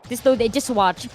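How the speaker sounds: ordinary talk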